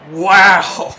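ordinary talk

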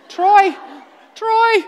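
high, loud call for Troy. Retold or imitated. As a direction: call out across the hills for her husband. Calling out to distant husband.

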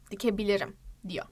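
normal talking voice

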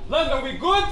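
loud, high call to the crowd. Real, not acted. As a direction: shout it out to the room. Rapper calls out to crowd.

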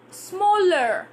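美式发音，smaller结尾卷舌 er; American "Smaller" with a curled r ending. Shown as a demonstration. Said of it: "I'm just telling you for American".

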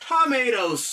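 tomatoes, said slowly and clearly with a tapped t; model pronunciation. Shown as a demonstration. Teacher modelling the English word tomatoes. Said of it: "Ou e kòman pwononse".

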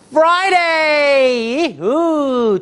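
high, bright cry of joy; excited Friday. Acted. Teacher to pupils, thrilled it's Friday. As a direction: sing out the day like it's a treat. Teacher cheers about the weekend.

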